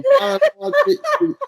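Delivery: helpless laugh at the memory; real laughing fit; high wheezing laugh. Real, not acted. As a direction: laugh high until it almost sounds like crying. Laughing mid-story.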